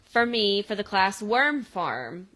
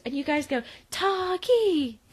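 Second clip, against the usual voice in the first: Jo's cheer; 7 semitones higher than usual. Retold or imitated. Mom teaching girls the team chant, high and eager. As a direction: show them an eager cheer. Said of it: "girls very unenthusiastically go".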